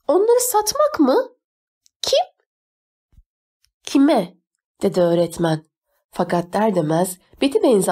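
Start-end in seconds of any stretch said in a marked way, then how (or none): 0.1-4.4 very high-pitched disbelief; aghast questioning. A narrator's reading. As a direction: question it in startled, soaring tones. Teacher aghast at selling them.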